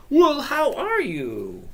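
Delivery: high, loud, drawn-out hello; sing-song, over-the-top welcome; exuberant greeting. Real, not acted. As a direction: greet them big, stretching it out slowly. Greeting a viewer from India.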